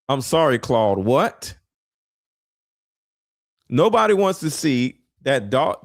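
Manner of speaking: ordinary talk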